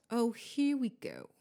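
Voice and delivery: bitter, weary grumble; mutedly resentful. A narrator's reading. Ghost host sees his rival arrive, bitter. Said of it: "said Nearly Headless Nick bitterly".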